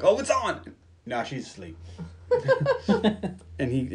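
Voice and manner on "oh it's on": high-pitched eager imagined reaction; playfully excited exclamation; mock excitement. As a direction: pipe up as if it's finally happening. Imagined eager reaction to her advance.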